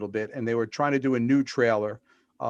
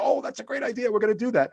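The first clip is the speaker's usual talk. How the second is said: excited, much higher-pitched impersonation; Lloyd imitation; 11 semitones higher than usual. Lloyd welcoming someone's idea, imitated in a high, eager voice. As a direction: exclaim it high, as if seizing on an idea. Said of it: "that's my Lloyd imitation".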